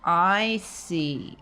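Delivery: usual speaking voice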